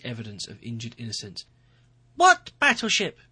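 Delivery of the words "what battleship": much higher, louder, slow mock astonishment; gasped. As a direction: feign total shock. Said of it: "he gasped".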